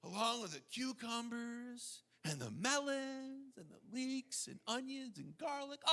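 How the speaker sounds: preacher lists Egypt's vegetables in mock rapture; dreamy mock longing. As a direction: murmur the foods like a fond daydream. Continues mock nostalgia.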